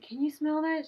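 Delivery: incredulous question. Retold or imitated. Retelling her alarmed question to companion.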